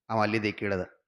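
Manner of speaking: usual speaking voice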